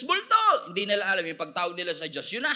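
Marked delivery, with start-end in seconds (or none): 0.0-0.7 high, loud shouted cry. Acted. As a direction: call out in acted alarm. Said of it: "Tawag sila ng tawag sa Diyos".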